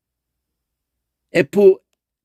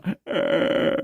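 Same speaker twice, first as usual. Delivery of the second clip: unvoiced throaty rattle of 에; uvular trill demo; 5 dB quieter than usual. Shown as a demonstration. 목젖 떨며 내는 에 소리. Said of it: "목조 떠는 걸로".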